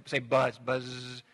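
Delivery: low buzz, the z drawn out like a bee; "buzz" with a long buzzing z; buzzing demo. Shown as a demonstration. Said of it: "Like buzz is an onomatopoetic word".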